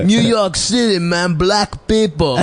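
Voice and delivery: high, drawn-out fake American accent; hammed-up foreigner doing an American accent; bad American accent. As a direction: imitate a Greek cousin's clumsy American accent. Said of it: "trying to do an American accent".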